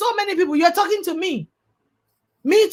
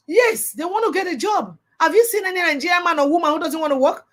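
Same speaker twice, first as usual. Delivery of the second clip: usual speaking voice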